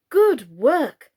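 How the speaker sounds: loud, high chorus of praise; cried out together. A narrator's reading. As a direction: cry it out as a group of dolls. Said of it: "cried all the Plantagenets".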